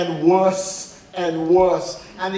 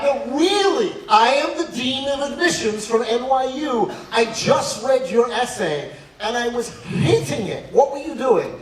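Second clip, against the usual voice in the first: higher-pitched, mock-exasperated dean; exasperated insistence; 6 semitones higher than usual. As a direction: insist you're genuine, voice pitched up. Dean calls back disbelieving kid, insists.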